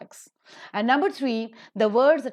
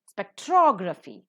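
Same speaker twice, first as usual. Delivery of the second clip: "Spectrography" stressed on TROG; stress on second syllable; 4 dB louder than usual. Shown as a demonstration. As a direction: hit TROG hard in "Spectrography". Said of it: "the second syllable is accented".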